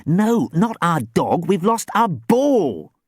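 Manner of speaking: slow, high, loud "lost our ball"; "ball" drawn out in emphatic correction. A narrator's reading. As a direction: spell out "not our dog" slowly and loudly. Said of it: "she said emphatically".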